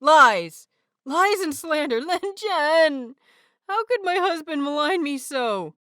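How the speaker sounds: high, loud hammed-up protest; mock outrage. A narrator's reading. Wei Ying protesting to his husband, loud hammed-up outrage. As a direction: wail the accusation with playful theatrics.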